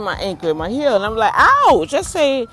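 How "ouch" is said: sharp, much higher, loud yelp of pain; pained ouch. Retold or imitated. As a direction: yelp as the heel gets squeezed. Doctor squeezing her sore heel.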